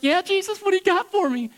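high, loud, eager reply; mock-eager follower, loud and high; eager believer. Voices an eager listener to Jesus.

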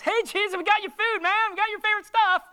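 high, loud, buddy-like call to Jesus; hammed-up cheerful friend with food; mock eager disciple. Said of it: "They're like".

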